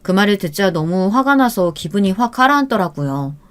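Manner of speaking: plain reading voice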